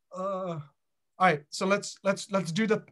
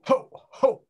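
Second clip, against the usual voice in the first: a loud, louder chant of ho ho; 4 semitones higher, 4 dB louder than usual. Shown as a demonstration. Leader shows the group the loud laughter chant. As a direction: belt out the ho ho. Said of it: "you have to do it loud like that".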